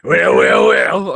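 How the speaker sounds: a loud, laughing attempt at a British accent that slips toward Fat Albert; failed British accent landing nearer Fat Albert; bad British accent. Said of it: "Is that Fat Albert?".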